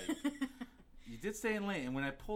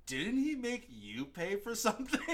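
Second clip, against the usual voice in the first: high, drawn-out incredulous question; retold indignant disbelief; 8 semitones higher, slower than usual. Host quoting own reaction to the rich date, incredulous. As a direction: replay your outraged question, slow and high. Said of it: "I was like".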